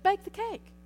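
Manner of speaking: high, loud, punched BAKE the cake; the preacher urging the class to obey; emphatic command. Shown as a demonstration. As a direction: hit "bake" hard like an order. Urging obedience, repeated command.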